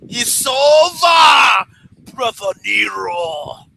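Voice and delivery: slow, high, shouted wrestling villain drawl; Broken Matt Hardy impression. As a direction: stretch it out like a theatrical wrestler taunting. Quoting Broken Matt Hardy catchphrase.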